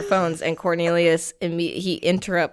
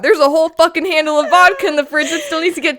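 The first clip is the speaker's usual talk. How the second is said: louder, higher exasperated rant; 8 semitones higher, 6 dB louder than usual. Retold or imitated. Retold exasperation.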